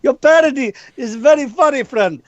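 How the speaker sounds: a Russian man with a friendly warning, in a thick put-on accent; mock Russian accent, teasing a co-host about his parody. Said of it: "Russian man is going to walk up to you and be like".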